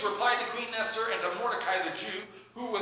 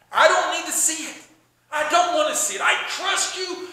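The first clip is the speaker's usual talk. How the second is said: emphatic assurance; 7 semitones higher, 8 dB louder than usual. Retold or imitated. King reassures Esther emphatically.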